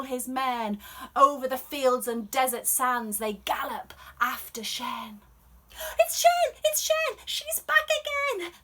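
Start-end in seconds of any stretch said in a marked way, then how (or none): 6.0-8.5 much higher, loud excited call; excited villagers. Acted. Neighbours shout that Shen is back, much higher and excited. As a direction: cry out the news of her return.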